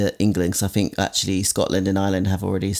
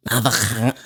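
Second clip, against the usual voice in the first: Scouse accent with a throaty K in avocado; hammed-up Scouse fella. Shown as a demonstration. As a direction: lay on thick Liverpudlian. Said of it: "Is that a good accent?".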